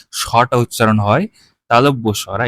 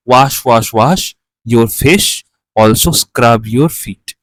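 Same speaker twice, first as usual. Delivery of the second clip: put-on slow children's rhyme, read out louder; 3 dB louder, much slower than usual. (একটু জোরে ছড়া পড়া)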